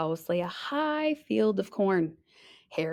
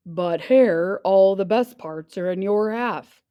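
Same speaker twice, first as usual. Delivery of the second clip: louder, aggrieved bear voice; grumbling bear; 5 dB louder than usual. Acted. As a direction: complain like a cheated bear. Bear complains.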